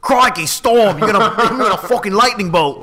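mock Australian villain voice; cartoon Australian accent. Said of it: "he was Toad in X-Men".

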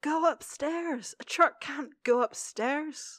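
high, drawn-out frustrated plea; stretched-out, incredulous exasperation; mock exasperation. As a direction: plead at the screen, slowly and high. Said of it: "I was getting frustrated at".

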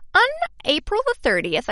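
put-on American accent. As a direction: slip into an American accent, pitched high and loud. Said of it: "I'm going into my American accent".

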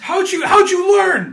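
loud, high, drawn-out demand; hammed-up movie yell; mock shout. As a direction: bellow the question slowly like a stunned warrior. Acting out astonished Vikings in film scene.

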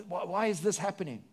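usual speaking voice

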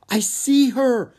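high, loud, drawn-out excitement; thrilled, high and slow exclamation; excited stage whisper. A narrator's reading. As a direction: squeal it slowly, a whisper in name only. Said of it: "she whispered".

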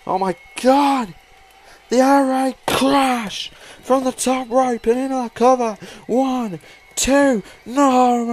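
loud, excited ringside call; excited shout. Real, not acted. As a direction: yell the near fall out. Excited call of near fall.

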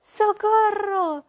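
slow, loud, high shout; dwarf yells it from the water; frantic cry for rescue; yelling for help. Acted. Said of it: "gritaba el enano".

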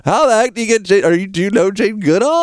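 excited gush of wonder; amazed. Real, not acted. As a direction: starstruck disbelief at the name. Host amazed by Jane Goodall endorsement.

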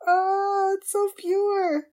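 high, loud, overwhelmed gush; near-tearful gushing. Real, not acted. Viewer overcome by how pure it is, near-tearful gushing. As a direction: gush as if about to cry. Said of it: "I'm gonna cry".

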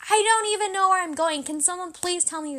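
high, exasperated retelling that eases off; frustrated plea. Retold or imitated. Daughter re-enacting her plea to be told, frustrated. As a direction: act out frustration, starting high then dropping. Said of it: "Really, really frustrated".

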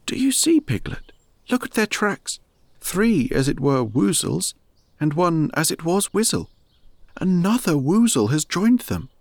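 a gentle puzzled bear thinking aloud; a hint of a pondering teddy bear; Pooh Bear. Pooh excited about tracks.

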